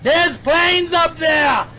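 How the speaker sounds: loud, slow pointing it out; emphatic call-out. Real, not acted. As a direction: call it out to the viewers. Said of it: "if you listen carefully".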